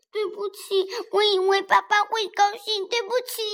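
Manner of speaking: put-on high childlike apology; lightly voiced little dinosaur; apologetic child. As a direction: say sorry sweetly.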